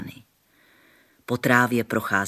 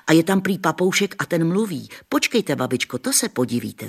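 put-on higher, louder boyish exclamation; excited boy exclaiming; 6 semitones higher, 4 dB louder than usual. A narrator's reading. Jan telling grandma about the parrot, excited. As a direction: call it out like a keen boy. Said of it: "zvolal Jan".

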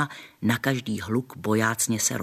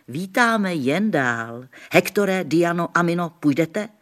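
snapping at dogs; 5 semitones higher, 4 dB louder than usual. A narrator's reading. Gamekeeper welcoming grandma and scolding dogs, loud. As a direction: shout the dogs down. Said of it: "obořil se na psy".

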